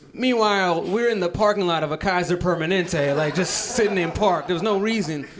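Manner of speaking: usual speaking voice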